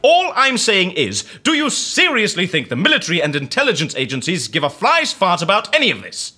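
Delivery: scornful. Acted. Scornful challenge in an argument.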